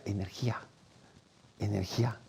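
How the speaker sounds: very soft, breathy answer; hushed reply. Retold or imitated. A monk answering the young man, hushed. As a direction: say it like a secret.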